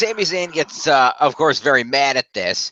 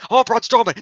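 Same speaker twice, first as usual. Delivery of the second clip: demanding Sami; 8 semitones higher than usual. Retold or imitated.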